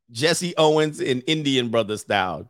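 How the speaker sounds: ordinary talk, nothing marked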